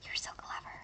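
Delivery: soft teasing whisper. Pretend. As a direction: breathe the praise in a whisper. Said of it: "repeated teasing whisper".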